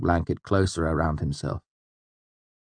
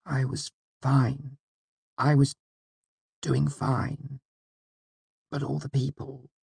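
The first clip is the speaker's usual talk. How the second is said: thin, high and hushed, breaking on the words; a fragile man barely holding together; frail cracking voice; 7 semitones higher, 5 dB quieter, slower than usual. Acted. Asylum patient Wynne answers Elodin softly, voice cracking. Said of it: "He spoke softly, his voice cracking a little".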